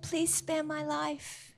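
soft, slow plea; pleading Esther. Retold or imitated. Esther begs the king, soft and slow. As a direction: beg quietly for your life.

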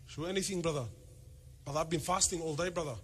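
low, soft, hurried husband's grumble; whiny fasting husband. Retold or imitated. Husband complaining to his wife about simple food, muttered low and quick. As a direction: grumble it like a hungry husband who fasted all day.

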